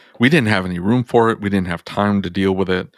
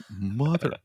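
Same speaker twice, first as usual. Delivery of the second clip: mad; 4 semitones higher, slower than usual. Real, not acted. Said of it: "I got so mad".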